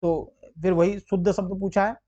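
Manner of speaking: ordinary talk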